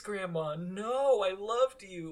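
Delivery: high, pleading cry of betrayal; high, betrayed mock cry; anguished plea. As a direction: wail it up high like a betrayed grandchild. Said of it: "And I was like".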